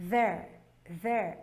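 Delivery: "there" with an English th, not a d; correct th sound. Shown as a demonstration. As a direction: say "there" with the tongue between the teeth, blowing air. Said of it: "se você falar corretamente".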